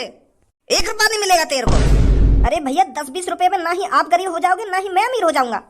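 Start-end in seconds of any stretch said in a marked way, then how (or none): 0.0-2.5 every word slow and louder; slow firm refusal. Acted. Shopkeeper refusing the beggar, slow and louder. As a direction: spell out the refusal slowly.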